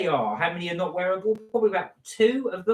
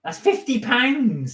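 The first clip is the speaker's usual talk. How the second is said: slow, drawn-out country "pounds", pitched up; country accent; 6 semitones higher, slower than usual. Real, not acted. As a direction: say "fifty pounds" in a broad English country accent. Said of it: "Pounds said by [name] with such a country accent".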